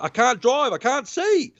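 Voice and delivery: high-pitched mock panic; panicked woman. Retold panicked woman without glasses.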